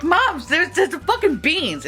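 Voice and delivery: high, drawn-out exasperated protest; mock exasperation. Daughter to mom about magic beans, mock exasperated.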